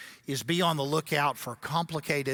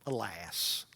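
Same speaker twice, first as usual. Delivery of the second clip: ordinary talk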